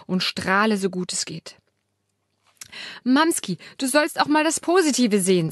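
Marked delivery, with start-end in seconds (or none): 3.0-5.5 scolding. Acted. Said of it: "sagt Jenny tadelnd".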